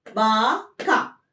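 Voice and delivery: Baka drawn out very slowly, with a rising end; very slow model word. Shown as a demonstration. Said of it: "Like is उबे".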